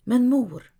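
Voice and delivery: ordinary delivery, nothing marked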